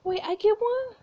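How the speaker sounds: put-on high, hopeful little kid; excited child. Child learns he gets a robot.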